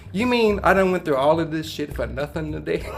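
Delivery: half-laughing mock disbelief. Son to dad on the riverbank, laughing in disbelief. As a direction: laugh through the words at yourself.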